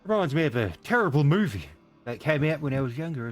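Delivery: ordinary talk, nothing marked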